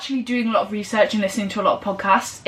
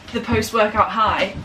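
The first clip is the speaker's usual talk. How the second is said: ordinary delivery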